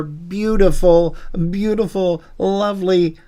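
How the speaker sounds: slow, high, gushing; gushing praise. Pretend. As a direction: lay on the flattery. Gushing over a guest.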